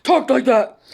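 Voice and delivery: loud, high imitation, forceful without anger; booming stepfather voice. Daughter shows how stepfather talked when not angry, booming. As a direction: blare it out as ordinary conversation. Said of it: "he wasn't angry. He just talked like".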